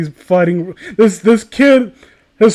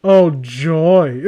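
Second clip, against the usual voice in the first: ordinary talk, nothing marked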